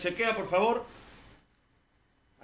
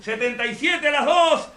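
auctioneer call; 6 semitones higher, 7 dB louder, slower than usual. Pretend. Mock auction countdown.

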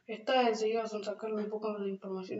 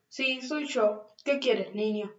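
ordinary delivery

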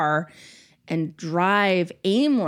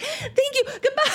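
frantic thank-you. Retold or imitated. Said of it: "And I was like".

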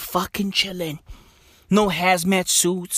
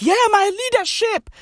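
much higher, squeaky mock admirer; mock fan voice; 12 semitones higher than usual. Comic mocking fans praising a homeless leader in a high put-on voice. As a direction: gush like a fawning fan. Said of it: "everybody's like".

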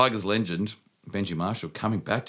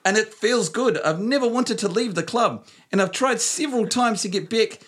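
put-on high broad Kiwi accent, breaking into laughs; 13 semitones higher than usual. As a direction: [name] style Kiwi voice. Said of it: "The flight of the Conchords is here".

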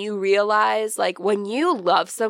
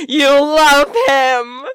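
swooning; 4 semitones higher, 9 dB louder, much slower than usual. Retold or imitated. Acting the audience's thought.

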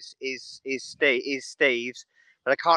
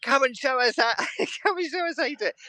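high mocking voice, laughing through it; a teasing high imitation of Steve calling him out; quoting Steve; 10 semitones higher than usual. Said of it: "Steve was like".

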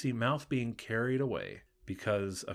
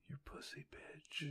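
whispered; 6 semitones higher, 14 dB quieter, slower than usual. Pretend. Crude joke line in quote.

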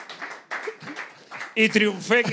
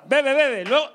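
egging on; 5 semitones higher, 5 dB louder, slower than usual. Real, not acted. Urging guest to drink.

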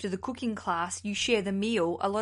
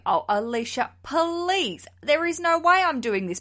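higher, mildly exasperated mock objection; imagined protest; 7 semitones higher, 5 dB louder than usual. Listener objecting to the host's first suggestion, mock protest. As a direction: play a listener balking at the idea. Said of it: "you're gonna be going".

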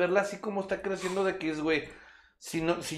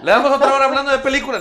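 higher, louder laughing quip; 10 semitones higher, 13 dB louder, faster than usual. Real, not acted. Laughing to the group about going off topic. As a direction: laugh it out as a joke.

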